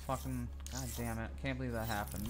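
low-volume grumble to himself; dejected muttering. Real, not acted. Gamer still sore about choking, dejected muttering. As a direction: mutter it very softly, deflated.

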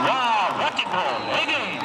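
slow, high, drawn-out goal cry; excited goal call. Real, not acted. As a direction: stretch out the goal call in awe. Goal scored live.

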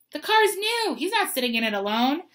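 high, loud objection that drops after the start; indignant refusal. A narrator's reading. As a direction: object sharply, then lay down the law. Uncle refuses to leave boy in car.

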